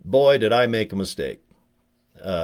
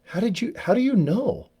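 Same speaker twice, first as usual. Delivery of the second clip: much higher, startled how do you know; shocked high squeak; 12 semitones higher than usual. Retold or imitated. Teen caught out by the lady, squeaking in mock alarm. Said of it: "And I go".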